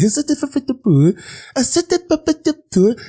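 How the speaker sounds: put-on high, strained French accent mangling English; French guy's English. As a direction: ape a Frenchman fumbling his English. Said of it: "he was speaking French and shit".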